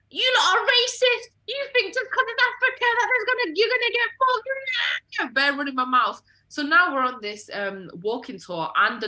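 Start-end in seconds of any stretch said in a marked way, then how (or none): 0.1-5.3 very high, loud indignant squeal; mock outraged shouting. Retelling retort to friends warning of chain snatching, high outraged shout. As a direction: shriek the accusation in high indignation.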